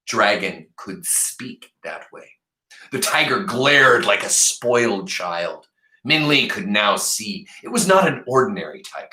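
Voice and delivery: plain reading voice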